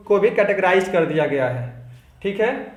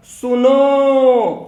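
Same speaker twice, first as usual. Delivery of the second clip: सुनो stretched long, high and loud like calling someone far away; calling सुनो, vowel held very long; long drawn-out call; 5 semitones higher, 7 dB louder, much slower than usual. Shown as a demonstration. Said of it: "जैसे मैं खींच रहा हूं ना अपनी voice को".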